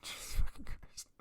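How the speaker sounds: a real, horrified whisper to himself; hushed and appalled; stunned whisper. Real, not acted. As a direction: breathe it out in disbelief, no voice. Shocked by the gruesome killer.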